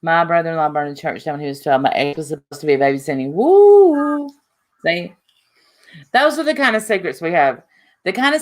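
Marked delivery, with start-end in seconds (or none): 3.3-4.3 high, loud drawn-out "woo"; loud whoop. Acted. Telling co-host about burned church, loud whoop. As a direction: whoop it like a siren going up. Whoop inside story of fire.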